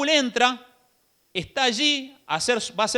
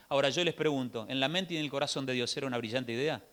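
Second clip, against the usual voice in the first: much softer and lower, searching; quiet probing question; 8 semitones lower, 9 dB quieter, faster than usual. Real, not acted. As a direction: lean in and ask them quietly. Preacher drops to probing question. (pregunta a la congregación en voz baja y grave)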